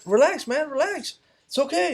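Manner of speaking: high-pitched urgent plea to relax; acted-out high, anxious reassurance; retold pleading. Pleading with cop pointing gun.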